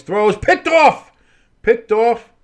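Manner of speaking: high, loud play-by-play shout, stretched out; excited call. Real, not acted. Announcer calling a shock interception, shouting.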